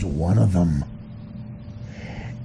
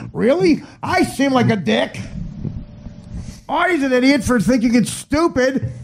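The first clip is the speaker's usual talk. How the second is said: much higher, gushing put-on voice; mock gushing host; 11 semitones higher than usual. Comic mocking host gushing over a celebrity. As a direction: fawn over the guest, oozing.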